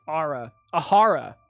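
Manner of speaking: ordinary talk, nothing marked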